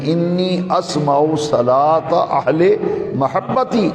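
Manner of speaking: slow, drawn-out Arabic recitation; hadith recitation. Real, not acted. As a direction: stretch the long vowels, measured and deliberate.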